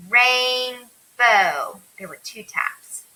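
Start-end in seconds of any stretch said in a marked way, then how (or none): 0.1-1.8 "Rainbow" stretched loudly into two long beats; "Rainbow" split, each half held; syllable by syllable. Shown as a demonstration. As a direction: say "Rainbow" in two drawn-out chunks. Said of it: "your chin taps your hand when you say it".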